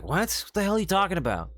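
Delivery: high, fast, baffled and indignant; baffled outburst. Retold or imitated. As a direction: act stunned at the nonsense. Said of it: "They'll be like".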